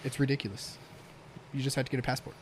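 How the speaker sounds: normal talking voice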